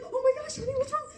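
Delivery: high, loud fussing mom with a laugh; imitated alarmed mother. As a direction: mimic a panicked mom on the phone. Acts alarmed mother on the phone.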